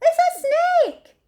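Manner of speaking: very high, loud panicked scream of help; frightened yell. A narrator's reading. Child runs from the snake, yelling in mock fright. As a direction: run off shrieking in fright.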